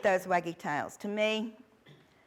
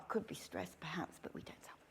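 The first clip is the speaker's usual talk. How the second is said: conspiratorial whisper, barely audible; whispered aside; 3 semitones lower, 11 dB quieter than usual. Shown as a demonstration. Instructor confiding to trainees what not to tell handlers, whispering. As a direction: drop to a secret whisper so the handler won't hear.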